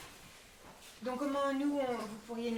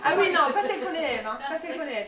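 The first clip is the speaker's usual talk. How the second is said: laughing; 7 semitones higher, 14 dB louder, faster than usual. Real, not acted. Laughs about the trout.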